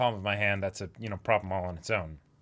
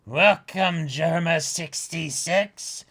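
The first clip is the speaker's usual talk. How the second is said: grand welcome; 6 semitones higher, 4 dB louder, slower than usual. Acted. Voicing Big Mom greeting.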